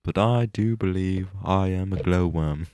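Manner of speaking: slow, measured mock orator voice; Winston Churchill. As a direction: recite it slowly with put-on gravity. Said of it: "what the context of this quote is".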